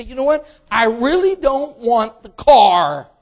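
loud, slow, exasperated refusal; fed-up buyer. Acted. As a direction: hand the keys back in disgust. Buyer gives up the car.

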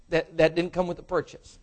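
usual speaking voice

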